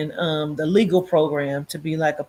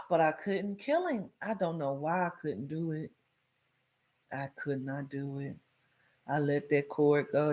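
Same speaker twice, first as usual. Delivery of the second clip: softly confessing; 11 dB quieter than usual. Real, not acted. Confessing she let go.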